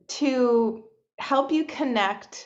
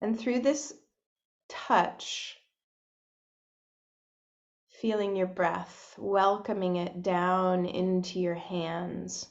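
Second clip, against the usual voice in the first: soft, unhurried meditation voice with long pauses; hushed, spacious guidance into breath and body; guided meditation; 4 dB quieter than usual. Real, not acted. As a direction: gently guide listeners inward, leaving space between phrases.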